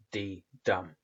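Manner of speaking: "d, dum" with a short "d" and a long "dum"; short-long iamb. Shown as a demonstration. Teacher demonstrates an iamb to students, "d, dum" short then long. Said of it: "a short and long syllable".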